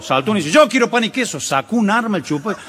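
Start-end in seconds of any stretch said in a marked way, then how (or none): 0.5-1.4 higher, louder, taking the bait; defiant answer. Retold or imitated. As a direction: answer the bully boldly. Someone accepts the taunt. (un hombre aceptando el desafío, alto y fuerte)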